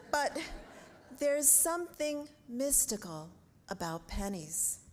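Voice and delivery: measured, projected pulpit reading with emphatic key words; prepared sermon delivery. Real, not acted. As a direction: preach it to the congregation, phrase by phrase.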